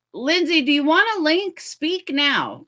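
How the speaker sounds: high, loud call to a viewer. Real, not acted. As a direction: raise the voice to call someone. Calling out to a chat viewer.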